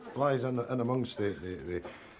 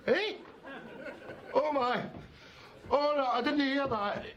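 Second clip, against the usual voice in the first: high-pitched protest, far above his usual voice; outraged disbelief. Acted. Cartoonist hearing his creation is being killed, outraged disbelief. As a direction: cry out in shocked denial.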